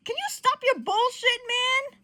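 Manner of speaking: high, loud irritated demand; exasperated. Acted. Friend telling Johnny to stop, exasperated high demand.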